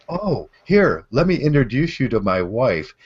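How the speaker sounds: gushing mock pride, pitch swooping up; happy, proud husband. Man showing off his new wife to old bar friends, happy. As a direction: imitate a delighted introduction, high and loud. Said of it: "He was so happy".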